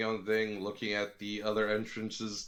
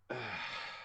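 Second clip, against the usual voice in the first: exasperated sigh; 8 dB quieter than usual. Acted. Baylor gives up and goes to sit, exasperated sigh. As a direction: let out a soft, weary huff. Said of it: "let out an exasperated".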